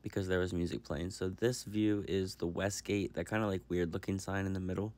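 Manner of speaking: soft, calm recorded voiceover; voiceover narration. Real, not acted. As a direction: narrate quietly over the footage. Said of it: "it is voiceover time".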